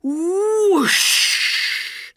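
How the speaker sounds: high, loud whooshing sound effect; a burst of magic smoke; dramatic swoosh. Acted. Genie appears in smoke.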